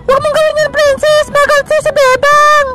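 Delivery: high-pitched protesting voice; scolding little character. Acted. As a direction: warn the prankster in a high little voice. High character warns prankster to stop. (mataas na boses na nananaway)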